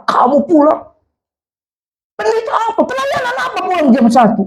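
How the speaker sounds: high-pitched mock scolding; angry father. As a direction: rant like a father woken at one a.m. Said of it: "Makanya dia marah". (omelan ayah yang marah, melengking)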